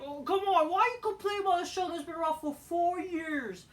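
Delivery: high, sneering mock of fans; sarcastic imitation of complaining fans; mocking whiny fans. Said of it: "people are like saying".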